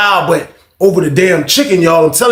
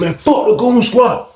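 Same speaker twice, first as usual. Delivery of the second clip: acted defiance; 9 semitones higher than usual. Inmate warned of goon squad, defiant acted anger. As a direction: imitate a fearless inmate shouting them off.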